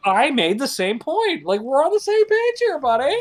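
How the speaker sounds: very high, loud goofy voice; squeaky mock voice. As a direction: squeak it like a cartoon buddy. Joking agreement with host.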